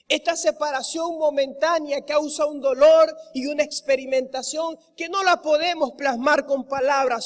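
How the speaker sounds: impassioned sermon delivery, hammering the repeats; fervent preaching. Acted. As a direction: drive each line home to the congregation. Preaching at full force.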